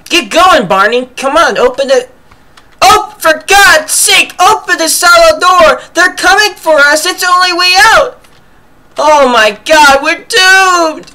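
high frantic panicked shouting; panicked yelling. Acted. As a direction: yell in panic, voice cracking high. Voicing panicked scientist in game.

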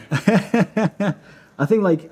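amused laugh spilling into speech. Real, not acted. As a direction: giggle through the words. Laughing about Iceland's only train.

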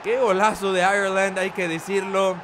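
shouted commentary. Acted. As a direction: belt it out like a stunned commentator admitting a great goal. Opponent's equaliser called live.

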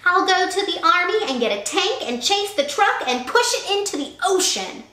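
high loud ranting bully. A narrator's reading. Sammy outdoes Kevin's plan, ranting bully. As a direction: escalate like a furious kid. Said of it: "Sammy escalates threats".

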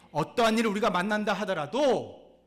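sudden loud, high preaching cry; preaching burst. Acted. Preacher to congregation, a loud high cry. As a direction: belt it out to the congregation.

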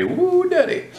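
high, delighted whoop of admiration; excited whoop. Real, not acted. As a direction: let it burst out high with glee. Admiring a mean looking rig.

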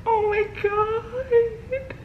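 high, tearful gasps of disbelief, slowed down; real tears of joy breaking through; happy crying. Real, not acted. Alone at her computer, reading her match result, sobbing with joy. Opening match email, overwhelmed.

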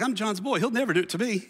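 high-pitched cocky boast; lightly voiced overconfident follower; smug mock disciple. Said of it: "They're like".

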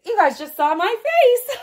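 giggling through the words, high; giggly, then a high little laugh. Real, not acted. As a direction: laugh at yourself, embarrassed. Laughs at her own reaction.

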